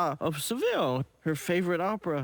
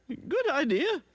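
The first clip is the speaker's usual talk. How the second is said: Miss Bleating; 7 semitones higher than usual. A narrator's reading. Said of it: "Miss Bleating replies".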